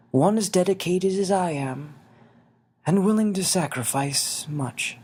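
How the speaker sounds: put-on a small old man asking kindly; little winged man. Xavier's voice.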